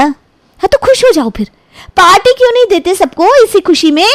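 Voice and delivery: lightly voiced girlfriend teasing. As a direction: girlfriend teasing her boyfriend for a party, playful.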